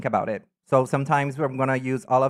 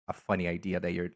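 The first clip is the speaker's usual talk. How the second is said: normal talking voice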